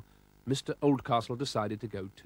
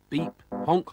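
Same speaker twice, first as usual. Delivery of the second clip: usual speaking voice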